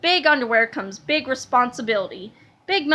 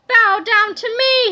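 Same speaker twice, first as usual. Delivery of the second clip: slow, loud, higher command; 7 semitones higher, 7 dB louder, slower than usual. A narrator's reading. Melvin commanding the captain, slow and loud. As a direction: demand it slowly. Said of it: "he commanded".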